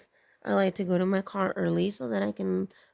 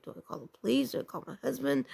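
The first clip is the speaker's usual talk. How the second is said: higher, hushed anxious self-questioning; retold panic; 5 semitones higher, 5 dB quieter, faster than usual. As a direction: think aloud in a mild, quiet panic. Panicked thoughts during scare.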